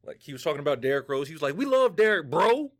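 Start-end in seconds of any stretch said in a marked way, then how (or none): 1.6-2.7 high and loud, punching BRO like a curse; super-hard BRO. Retold or imitated. Imitating Uber driver talking about Derrick Rose, super-hard bro. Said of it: "Why are you saying bro that way?".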